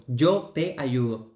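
"Yo te ayudo" slow, with "yo" stressed; slow example. Shown as a demonstration. Teacher repeating the example for students, slowly, with "yo" stressed. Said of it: "¿Cierto?".

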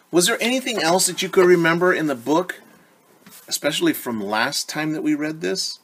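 ordinary talk, nothing marked

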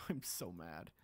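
whispered, soft defeated grumble; muttered under breath. Real, not acted. Gamer grumbling to himself about lost sword, whispered. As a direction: breathe it out almost silently.

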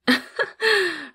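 short high falling cackle; high smug cackle. Acted. Boss dismissing her assistant's name, high cackle. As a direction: cackle with contempt.

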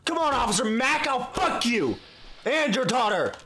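hammed-up taunt; mock taunt. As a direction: goad the guard. Taunting a game guard.

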